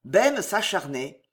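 plain reading voice

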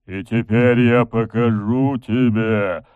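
slow, menacing drawn-out threat; demon voice. A narrator's reading. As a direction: stretch the words with menace. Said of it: "Это был голос демона".

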